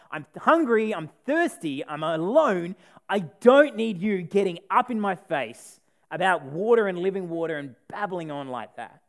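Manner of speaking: hurt anger. Retold or imitated. Preacher voicing the lonely woman lashing out at Jesus, hurt and angry. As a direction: pour out her hurt and anger. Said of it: "She's angry".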